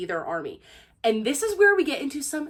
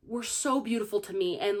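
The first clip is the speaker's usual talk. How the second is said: ordinary delivery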